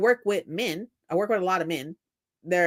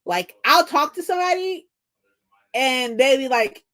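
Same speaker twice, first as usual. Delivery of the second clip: normal talking voice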